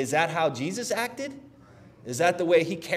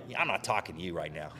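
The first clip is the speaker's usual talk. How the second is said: sulky freeze-out; 11 semitones lower, 11 dB quieter, faster than usual. Retold or imitated. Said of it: "You're like".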